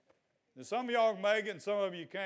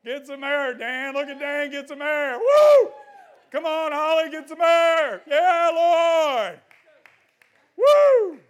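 building from urging to high, loud whooping shouts; exuberant shouting; 10 semitones higher, 15 dB louder, slower than usual. Real, not acted. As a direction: whip the crowd up until you're yelling. Pastor urging congregation to jump.

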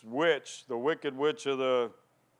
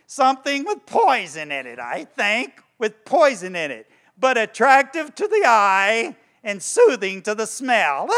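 high, loud witch voice brewing poison; gleeful witch over her potion; witch casting a spell; 7 semitones higher, 7 dB louder than usual. Retold or imitated. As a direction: croon the recipe like an evil witch. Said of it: "She's doing a spell".

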